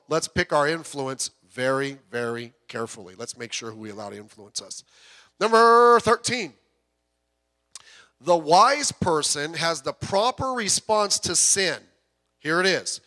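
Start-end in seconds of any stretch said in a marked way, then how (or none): 5.4-13.0 louder, higher preaching with slow, heavy phrases; full-force preaching. Acted. Pastor preaching to the congregation about fleeing sin, full force. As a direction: drive each point home from the pulpit.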